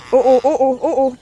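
loud laughing oh oh. Real, not acted. Birds swarming her, loud laughing cries. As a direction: laugh through the words.

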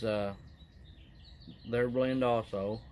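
talking around pipe stem; pipe in mouth. Real, not acted. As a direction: teeth clenched on pipe. Seen 1: pipe out of mouth 0.3–3.8, clenched in teeth from 4.5 on.

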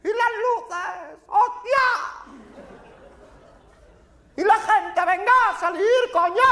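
furious shouting, high and loud; panicked rage of someone locked in; angry yelling. Acted. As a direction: bang on the door and bellow to be let out. Locked-in spectator yelling to get out.

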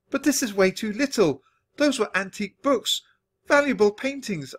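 high-pitched dismayed protest; crying out in dismay. A narrator's reading. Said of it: "I cried".

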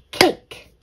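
"cake" punched loud as one beat; clapped-out syllable. Shown as a demonstration. Teacher clapping out the syllables, "cake" in one beat. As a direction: hit "cake" in a single sharp, loud beat. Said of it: "Let's clap out the syllables".

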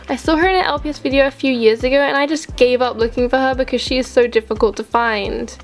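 normal talking voice

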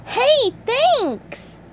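high, breezy cartoon sponge; SpongeBob voice. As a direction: toss it off, barely looking. SpongeBob brushes off Patrick.

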